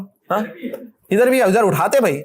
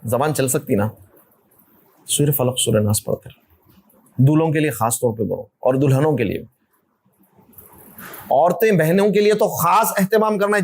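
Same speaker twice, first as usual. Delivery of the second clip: ordinary talk, nothing marked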